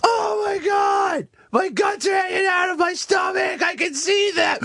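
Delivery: high screaming wounded soldier; dying soldier. Pretend. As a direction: shriek like a soldier gutshot on the battlefield. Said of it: "do your impression of a soldier".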